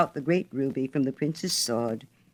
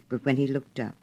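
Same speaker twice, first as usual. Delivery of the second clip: plain reading voice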